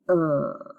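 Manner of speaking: er, a long r-colored vowel; er sound. Shown as a demonstration. Teacher modeling the sound er for students. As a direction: say er slowly and clearly. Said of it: "the U R will say".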